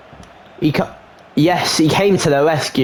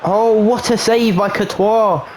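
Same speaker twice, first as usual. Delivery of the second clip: high, slow, stretched-out commentary; impressed commentator's exclamation; excited save call; 6 semitones higher than usual. Real, not acted. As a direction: marvel at the save, drawing it out high. Calling a big save in commentary.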